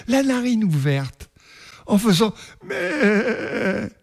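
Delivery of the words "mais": a plaintive goat's mê, high and crying; sad bleat. A narrator's reading. The pining goat bleating sadly in her pen.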